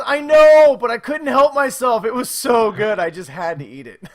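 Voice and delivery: high, loud mock little-girl pleading; daughter's excuse. Daughter explains why she ate the cookie, high childish plea. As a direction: play the guilty kid begging off. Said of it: "She says".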